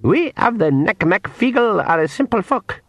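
high, slow Scottish-tinged pixie. Acted. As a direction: lay out terms with pixie cunning. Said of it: "he added".

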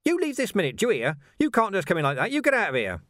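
high-pitched, outraged scolding; flustered, angry high voice; indignant outburst. A narrator's reading. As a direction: order an intruder out, voice shooting up. Householder ordering the intruder out.

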